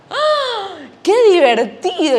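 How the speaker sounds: put-on a high, delighted squeal of a kid; thrilled little Juancito hearing about camping; excited boy. Boy told of family camping trip, excited squeal.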